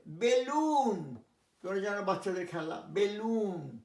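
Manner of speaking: বেলুন drawn out slowly; slow careful pronunciation. Shown as a demonstration. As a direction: stretch বেলুন long. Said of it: "teacher drills word". (ধীরে বেলুন)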